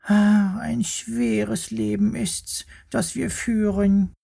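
very high, slow, self-pitying squeaky sigh; sighing worm. Acted. Worm sighs over his hard life, high and self-pitying. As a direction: lament like a tiny martyr. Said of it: "Er seufzte".

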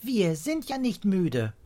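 high, slow, emphatic cry; childlike exclamation; child calling out. A narrator's reading. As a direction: call it out in protest, stretching the words. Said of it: "ruft Pia".

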